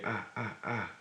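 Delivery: ah, ah, ah pushed from the throat; short ah, ah, ah bursts. Shown as a demonstration. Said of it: "it's almost like a scream".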